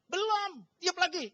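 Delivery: high-pitched, emphatic mock order; insistent command. Trainer making trainee keep blowing the balloon, insistent command. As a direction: act out a relentless trainer.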